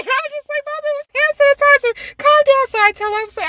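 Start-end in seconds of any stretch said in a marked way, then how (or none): 0.7-2.7 protesting daughter. Retold or imitated. Daughter protesting to mom, retold.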